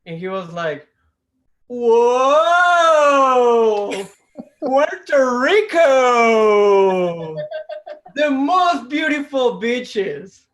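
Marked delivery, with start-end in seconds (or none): 1.7-10.5 loud, slow, dreamy gush; mock rapture. Bus guy raves about Puerto Rico, slow loud swoon. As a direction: swoon over the wrong country.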